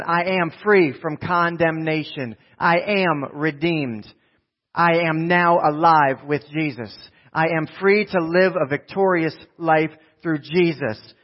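slow, emphatic, word-by-word declaration; congregational declaration. Real, not acted. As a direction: lead the crowd through it phrase by phrase. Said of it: "say it out loud with me".

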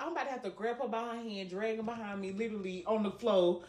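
telling friends her nervous plan to drag her; hushed aside. Retold or imitated. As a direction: drop to a near hush.

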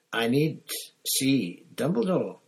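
desperate Crouch. A narrator's reading. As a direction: force each word out.

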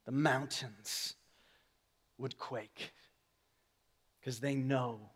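very soft, low, near-whispered preaching; low, reverent murmur with long pauses; hushed awe. Retold or imitated. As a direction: let each phrase fall into a hush. Hushed awe, voicing Jesus.

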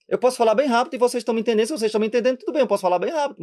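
rapid-fire, words run together; very fast. Shown as a demonstration. Priest proving to viewers that speed is understandable, very fast. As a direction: rattle it off at double speed.

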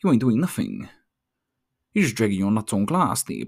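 ordinary talk

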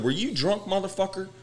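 1 mock confrontation. Retold confrontation.

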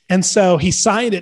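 ordinary delivery

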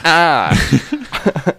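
short high scratchy moan, lightly voiced; a raspy little "ah" like Roseanne; scratchy Roseanne groan. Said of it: "Such a scratchy voice".

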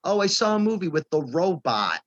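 lightly put-on Queens accent; a hint of an old Brooklyn guy. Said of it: "such a Brooklyn, Queens".

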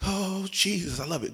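hushed mock prayer. Preacher retelling his prayer closet, hushed mock devotion. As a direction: murmur it like private prayer, half-mocking.

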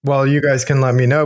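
normal talking voice

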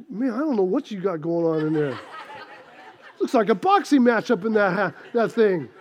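low, subdued mock bewilderment; dropped pitch, muted deadpan amazement; baffled village lady. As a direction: lightly act a neighbour taken aback by what she sees. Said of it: "Lady's like".

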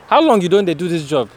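casual Pidgin question; Nigerian Pidgin. Real, not acted. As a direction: ask it in Naija Pidgin. Switches to pidgin with worker.

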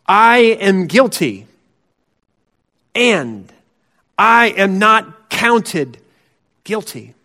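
slow, weighty confession with long pauses; slow solemn confession. Shown as a demonstration. Preacher leads the congregation in a slow confession. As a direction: lay down each word slowly, owning it. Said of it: "you and I can say this morning".

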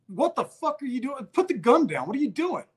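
high-pitched exasperated demand; indignant, pitch pushed up; retold heated confrontation. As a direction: re-enact scolding an armed acquaintance.

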